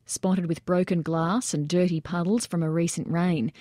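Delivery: usual speaking voice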